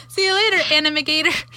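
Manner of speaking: bright laughing sign-off; playful high goodbye. Real, not acted. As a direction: sing out the silly goodbye.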